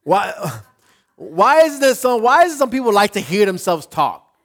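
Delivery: high, loud question through rising laughter; amused, fighting laughter. Real, not acted. Preacher amused at people who love hearing themselves talk, fighting laughter. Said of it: "That's why I was laughing".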